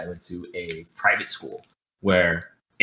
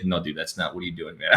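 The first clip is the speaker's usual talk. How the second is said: mock disapproval; 4 semitones lower, 10 dB quieter than usual. Friend rejecting wrong usage.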